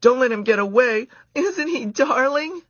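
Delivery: put-on high, loud girlish squeals; squealing girls. A narrator's reading. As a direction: squeal like excited campers. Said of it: "all the girls were squealing".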